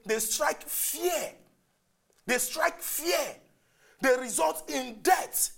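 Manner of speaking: slow, drawn-out key words; deliberate defining. Real, not acted. Host defining terrorism for his opponent, slow and emphatic. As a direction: lay out each phrase slowly and weightily.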